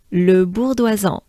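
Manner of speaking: "le bourgeoisan" as a native French speaker says it; natural French "le bourgeoisan", throaty R; French accent. Shown as a demonstration. Teacher modelling how a French person says it. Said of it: "I would say a French person".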